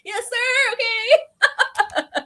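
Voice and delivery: high, loud, squealed obedience; hammed-up frightened squeak; mock fearful compliance. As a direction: imitate a scared yes-man, nearly laughing. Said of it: "I felt afraid".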